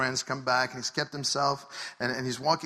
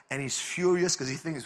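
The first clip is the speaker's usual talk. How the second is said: ordinary delivery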